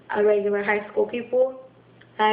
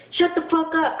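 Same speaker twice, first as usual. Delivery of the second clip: angry outburst; 6 semitones higher than usual. Retold or imitated. Retelling anger at a yelling mom, angry outburst. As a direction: let the irritation burst out higher. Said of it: "there's already anger towards her".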